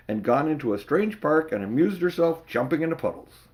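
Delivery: ordinary delivery, nothing marked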